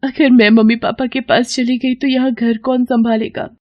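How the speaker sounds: high, hesitant wife voice; high-pitched, worried question; meek young wife. Acted.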